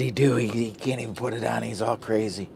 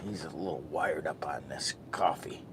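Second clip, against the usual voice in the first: whisper; 7 semitones lower, 13 dB quieter than usual. Real, not acted. Aside about himself.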